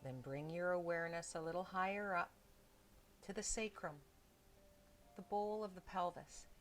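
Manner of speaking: soft, unhurried meditation voice with long pauses; hushed body-scan guidance, leaving space between phrases; guided meditation. Real, not acted. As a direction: gently guide them into stillness, phrase by phrase.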